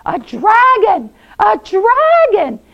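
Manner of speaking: loud, high cry of panic; screaming in alarm. Acted. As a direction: shriek a warning to the town. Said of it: "all the people started screaming".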